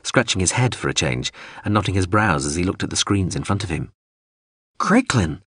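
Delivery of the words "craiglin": high, mildly raised call over a speaker; shouted call. Acted. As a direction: call the name out sharply. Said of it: "Yalsin's voice shouted".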